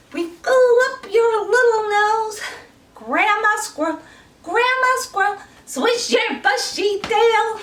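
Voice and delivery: high, sing-song granny voice; grandma voice. Shown as a demonstration. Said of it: "Now we're gonna do grandma squirrel".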